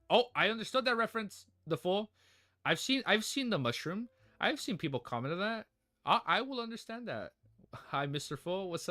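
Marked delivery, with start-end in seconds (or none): 0.1-7.3 excited. Real, not acted. Excited recognition of reference.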